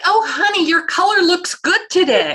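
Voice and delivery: high, bright-sounding mock cheer for a sickbed; sing-song fake cheer for a dying relative; falsely cheery visitor. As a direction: imitate a visitor putting on forced cheer. Visitors greeting a dying patient.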